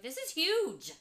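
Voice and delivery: suddenly struck by it; amazed realization. Retold or imitated. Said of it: "that you're like".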